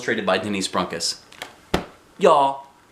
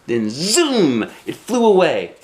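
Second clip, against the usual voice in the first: higher and louder, swooping up on zoom; excited zoom; 5 semitones higher, 6 dB louder, slower than usual. A narrator's reading. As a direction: let the bird burst away with glee. Bird suddenly flies off.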